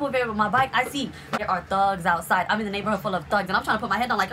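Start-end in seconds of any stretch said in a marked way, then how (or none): none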